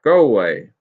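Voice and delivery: loud, stern shout at the cows. A narrator's reading. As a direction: bellow to drive the cows off. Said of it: "he shouted".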